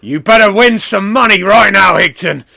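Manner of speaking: loud wrestler rant, easing at the end; booming promo-style demand; Mister McMania. Retold or imitated. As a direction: shout it like an irate wrestler. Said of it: "what's he sound like?".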